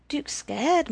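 plain reading voice

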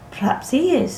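high, cool concession; lightly voiced coldness; cold. A narrator's reading. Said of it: "said Frances coldly".

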